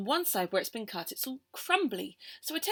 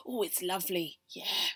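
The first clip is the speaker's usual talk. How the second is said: whispered; 4 semitones lower than usual. Acted. Savouring the cake.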